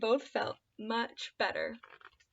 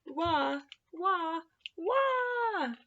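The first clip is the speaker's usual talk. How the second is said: soft sing-song wah, higher; mock wail; 7 semitones higher, 5 dB quieter, much slower than usual. A narrator's reading. Tree keeps wailing, a soft mock cry. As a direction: pretend baby wail, kept gentle. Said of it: "wailed just even louder".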